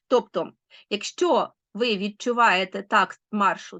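normal talking voice

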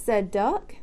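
ordinary delivery, nothing marked

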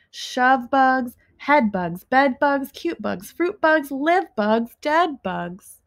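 ordinary delivery

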